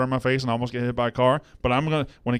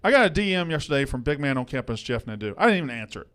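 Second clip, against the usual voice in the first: usual speaking voice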